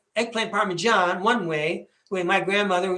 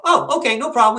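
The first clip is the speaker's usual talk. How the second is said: higher and louder, suddenly all eager; sudden eager agreement; 4 semitones higher, 6 dB louder than usual. Retold or imitated. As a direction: switch instantly to happy yes-man. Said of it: "he's all".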